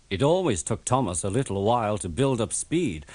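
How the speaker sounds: ordinary delivery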